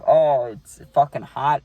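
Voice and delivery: slow, loud groan of complaint; groaning at the heat. Retold or imitated. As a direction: drag it out, sun-baked and miserable. Groaning at sun on face.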